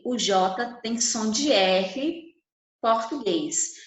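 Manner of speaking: ordinary delivery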